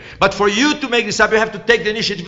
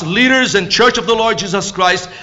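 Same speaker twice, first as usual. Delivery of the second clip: normal talking voice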